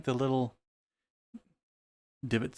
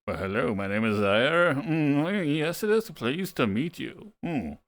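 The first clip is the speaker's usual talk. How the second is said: Zaire voice; slower than usual. Acted. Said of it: "Why would you make a different voice for yourself?".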